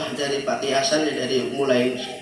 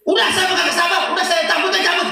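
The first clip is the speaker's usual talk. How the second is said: high, loud laughing outburst; high shouted exasperation; exasperated Jibril; 5 dB louder than usual. Acted. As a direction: give up on patience, fed up. Jibril losing patience.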